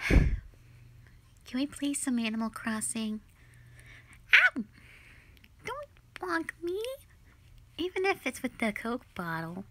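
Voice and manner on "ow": a sudden high loud "ow"; sharp yelp of pain. Acted. Gamer bonked by partner yelps loudly in mock pain. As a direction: yelp as the bottle bonks your head.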